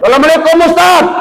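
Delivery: acted angry greeting, pitched very high; irate rising greeting. Mother returning after her child cried, acted high angry greeting. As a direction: throw the greeting out quick and high. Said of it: "Wah, intonasinya udah naik".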